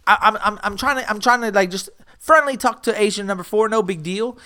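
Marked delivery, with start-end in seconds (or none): none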